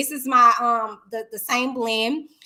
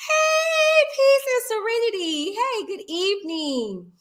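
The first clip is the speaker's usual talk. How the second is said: high sing-song greeting; 10 semitones higher, slower than usual. Real, not acted. Greeting chatters.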